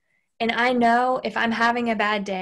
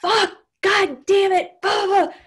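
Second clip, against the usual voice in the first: frustrated outburst; 9 semitones higher, 6 dB louder than usual. Retold or imitated. Venting to her partner in a frustrated shout. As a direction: let the frustration blow out at nobody.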